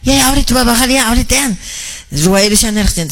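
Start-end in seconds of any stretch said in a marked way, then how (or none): none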